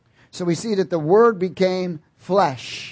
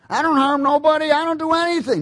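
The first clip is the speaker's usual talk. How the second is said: much higher smug self-defense; mock good person; 12 semitones higher, 5 dB louder, faster than usual. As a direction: whine it like a self-righteous believer. Mocking a self-righteous churchgoer.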